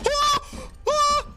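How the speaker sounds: shrill startled scream; sudden high-pitched yelp of terror. Real, not acted. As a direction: shriek out in fright. Screams in horror game.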